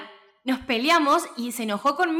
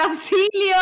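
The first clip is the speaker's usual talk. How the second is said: loud, high cry of help; trapped under a wardrobe, yelling for help; 10 semitones higher, 6 dB louder, much slower than usual. Retold or imitated. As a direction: call for help like a madwoman. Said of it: "y empecé a gritar".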